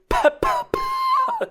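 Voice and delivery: very high, loud popping pa sounds; plosive demo. Shown as a demonstration. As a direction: hit the P hard to make it pop. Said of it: "Let's say I go".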